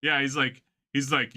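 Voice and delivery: usual speaking voice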